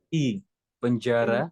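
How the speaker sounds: penjara with the mouth wide open and slow; open articulation. Shown as a demonstration. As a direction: open each vowel in penjara. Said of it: "mulutnya buka lagi coba".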